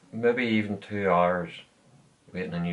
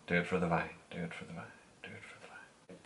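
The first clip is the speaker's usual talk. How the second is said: softer each time until whispered; fading to whisper; 3 semitones lower, 13 dB quieter than usual. Retold or imitated. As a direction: trail off into a whisper. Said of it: "Just sitting here thinking".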